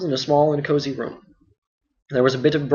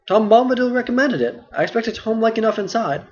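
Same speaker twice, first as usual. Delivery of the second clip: put-on a lightly higher, puzzled young hobbit; Frodo, mildly questioning; Frodo's voice; 8 semitones higher than usual. Frodo continues.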